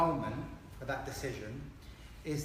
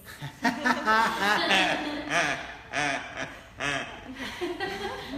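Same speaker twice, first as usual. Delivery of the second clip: hearty laugh; 7 semitones higher, 6 dB louder than usual. Real, not acted. Accent request read from chat.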